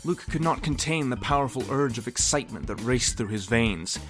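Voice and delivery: usual speaking voice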